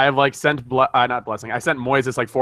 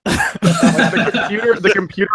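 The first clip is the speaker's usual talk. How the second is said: high, slow words through giggles; laughing explanation; 6 semitones higher, slower than usual. Real, not acted. Telling friends how the computer picked Jordan, laughing. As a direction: stumble over the words while laughing.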